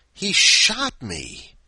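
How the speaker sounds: high, slow, loud cry of disbelief; shocked outburst. Acted. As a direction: blurt it out in total shock. Story's shock ending.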